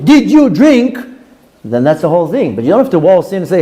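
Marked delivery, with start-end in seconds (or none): 0.0-1.0 high, pointed questioning; mock interrogating wife. Wife questioning husband who came home, high mock interrogation. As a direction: confront him, pitch raised on each word.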